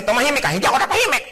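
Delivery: high, fast bickering; quarrel. Acted. Spouses bickering, each shouting the other down. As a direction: snap back in a quarrel.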